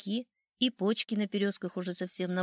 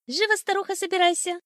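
high, loud, bossy bird cry; shouting old sparrow; 10 semitones higher, 7 dB louder than usual. A narrator's reading. Sparrow hurrying his wife to move, high urgent shout. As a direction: order her about in a high shout. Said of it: "крикнул старый воробей".

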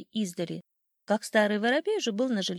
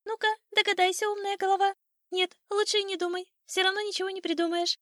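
high, quick, teasing bird voice; chirping old sparrow; 11 semitones higher, 3 dB louder, faster than usual. A narrator's reading. Old sparrow mocking the clever rooster, high quick chirping. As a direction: chatter tauntingly up high, fast. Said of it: "чирикнул с вербы старый воробей".

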